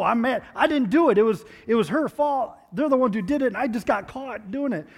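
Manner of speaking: pouting, indignant child voice, hammed up; mock angry kid. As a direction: play the wronged kid insisting it wasn't him.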